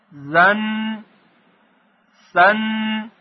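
teacher reading letters aloud for class to echo; teacher recitation. Shown as a demonstration. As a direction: chant the letters with tanvin for pupils to repeat.

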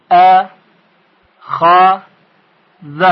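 ا، خ، ذ as separate short "a", "kha", "dha"; split into syllables. Shown as a demonstration. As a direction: sound out each letter with a short a, pausing between. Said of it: "به مثالهای بعدی توجه کنید".

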